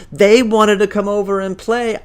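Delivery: put-on high, loud kid's whine; whiny blaming. Billy blames his friends to Mom, whiny blaming. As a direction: whine it loudly, pinning it on others.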